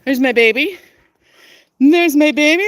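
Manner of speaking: excited loving hello to a pet; affectionate pet greeting. Real, not acted. Greeting her animal affectionately.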